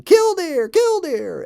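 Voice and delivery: high, loud piping bird cry; piped bird call. Acted. Plover piping an alarm over the possum, high and loud. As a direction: pipe it out as loud as a little plover can. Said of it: "and saying".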